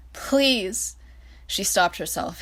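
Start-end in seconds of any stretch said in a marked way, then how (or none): 0.1-1.0 begging. A narrator's reading. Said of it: "resorted to begging".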